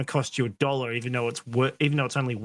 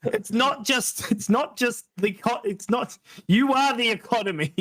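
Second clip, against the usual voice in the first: ranting; 8 semitones higher than usual. Real, not acted. Ranting climax, slow.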